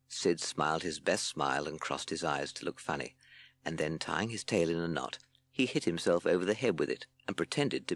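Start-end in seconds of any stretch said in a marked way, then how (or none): none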